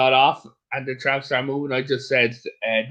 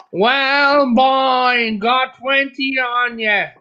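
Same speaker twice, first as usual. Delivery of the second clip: loud, high, slow street-thug demand; tough Dublin mugger; 9 semitones higher, 5 dB louder, much slower than usual. Retold or imitated. Mugger shaking down a tourist, loud and slow. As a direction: lean in and shake him down.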